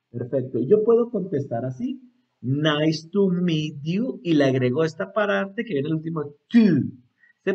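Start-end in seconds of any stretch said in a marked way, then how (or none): 2.4-4.2 very slow, drawn-out words; slow demo. Shown as a demonstration. Teacher showing how to answer back, very slowly. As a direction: stretch each word evenly for learners.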